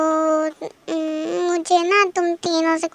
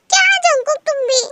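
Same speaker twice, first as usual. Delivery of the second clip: high, loud sisterly complaint; high whine; 9 semitones higher, 5 dB louder than usual. Acted. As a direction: whine at the brother. (बहुत ऊँची शिकायती आवाज़)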